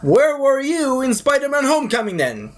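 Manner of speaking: high-pitched mock indignation; incredulous questioning. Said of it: "And I'm like".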